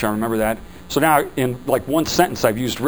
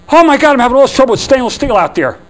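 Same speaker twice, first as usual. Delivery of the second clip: worried customer; 10 semitones higher, 7 dB louder than usual. Retold or imitated. Customer complaining to salesman.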